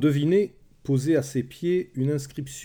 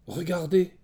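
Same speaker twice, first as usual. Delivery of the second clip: sudden raised-pitch exclamation; 6 semitones higher than usual. A narrator's reading. Woman on Troy's ramparts spotting the sea, exclaiming. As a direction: call out for the others to look. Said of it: "s'exclama la première".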